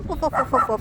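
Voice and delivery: playful imitation barks; mock barking. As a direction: bark back at the puppy playfully. Barking at dog.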